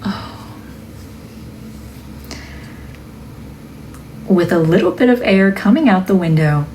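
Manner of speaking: upbeat narration for a product video; video voiceover. Acted. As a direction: read it like a cheerful product reveal. Reads the product voiceover script.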